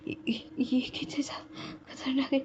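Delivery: hushed, stumbling whisper; weak whisper. Retold or imitated. Injured Benchao warning friends in a faint stumbling whisper. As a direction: gasp it out, too hurt to speak. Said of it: "बात भी नहीं कर पा रही थी".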